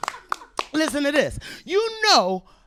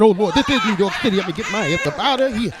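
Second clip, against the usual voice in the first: panicked fast; 4 semitones lower, 6 dB louder, faster than usual. Retold or imitated. Man fleeing in panic.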